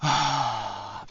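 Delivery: long, open exhaled sigh; sigh of relief. Real, not acted. Podcaster on letting go of rancor, relieved sigh. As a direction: let out a long sigh, shedding a weight. Relief before "tira um peso.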